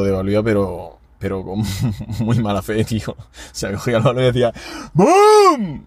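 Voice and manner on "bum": sudden high loud boom; mock kick boom. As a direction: shout the kick sound out. Chutando el balón lejos. (¡bum! agudo y fuerte imitando la patada)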